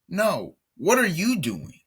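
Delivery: exasperated. Real, not acted. Commentator scolding the coach, high and exasperated. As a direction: fling the question at him.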